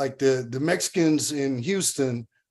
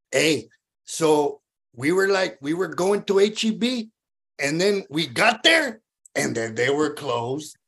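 Corpus cadence. Shown as a demonstration. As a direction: drag it out, then drop the last words. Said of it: "it goes something like this".

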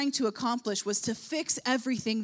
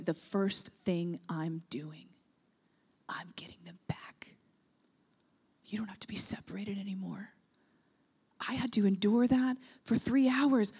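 whispering; 4 semitones lower, 5 dB quieter than usual. Retold or imitated. Preacher voicing Jesus whispering his resolve. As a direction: breathe it as a secret vow. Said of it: "And he's going".

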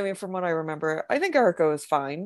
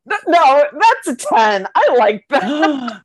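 excited; 8 semitones higher, 9 dB louder, slower than usual. Real, not acted. Loudly hyped by a weak cackle.

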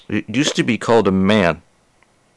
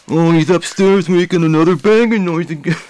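put-on voice; 7 semitones higher, 4 dB louder than usual. Voicing the neighbor's complaint.

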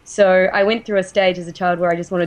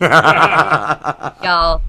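laughing; 3 semitones lower, 5 dB louder than usual. Real, not acted. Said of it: "you all just sound American".

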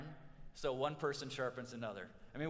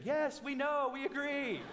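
mock cheering; 9 semitones higher than usual. Family cheering at the wedding, high mock cheer. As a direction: cheer along like the groom's family. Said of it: "his family started cheering".

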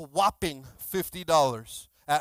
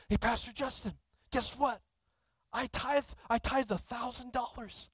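higher-pitched retold good news, mildly excited; retold excitement; 5 semitones higher, 4 dB quieter than usual. Man calls his pastor about tithing, mildly excited. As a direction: imitate an eager caller breaking news. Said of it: "he got so excited".

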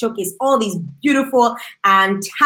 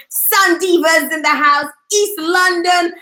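raised-pitch host's roll call; hype call-out; 6 semitones higher than usual. Real, not acted. Host hails East London viewers for Sun Divas, calling out. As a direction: call it out to the crowd, stretching the place name. Calling out guests and their city.

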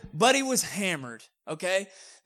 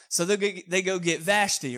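ordinary talk, nothing marked

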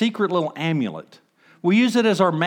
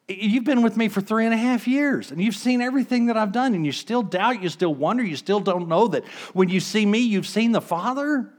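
exasperated plea; 6 semitones higher than usual. Acted. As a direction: press them, baffled they still doubt. Jesus voiced pleading with disciples.

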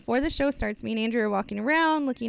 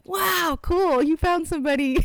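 excited mom; 7 semitones higher, 4 dB louder than usual. Retold or imitated. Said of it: "our moms were both like".

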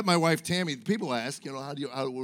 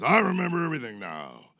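put-on old guy in ad; 2 semitones higher, 5 dB louder than usual. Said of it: "Some old guy comes out and goes".

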